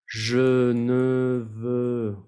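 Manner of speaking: Je ne veux very slow, three neutral e sounds; slow demo. Shown as a demonstration. Teacher modelling the vowels in Je ne veux. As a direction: stretch the e in Je ne veux. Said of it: "tres veces E".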